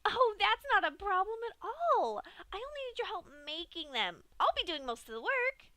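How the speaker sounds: high, loud, cheerful explaining; bubbly Pinkie. A narrator's reading. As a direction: brush off the worry brightly. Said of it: "Pinkie explained".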